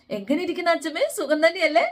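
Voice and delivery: put-on a young wife showing off her manners, bright and high; sweet greeting. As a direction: gush over grandma a little too sweetly. Daughter-in-law greets grandma sweetly.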